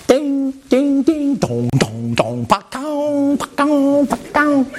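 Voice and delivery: high sing-song ding dong like phone alerts; phone notification sounds. Retold or imitated. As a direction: chime out the notifications like a buzzing phone. Imitating phone notification noises.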